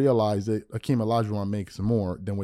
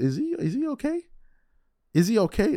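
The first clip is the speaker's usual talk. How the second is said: high-pitched, baffled mock worry; mock concern; 10 semitones higher than usual. Host recalling watching Gilbert argue, high-pitched mock concern. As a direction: act taken aback, voice jumping up high. Acted baffled reaction to screaming.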